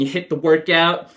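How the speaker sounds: normal talking voice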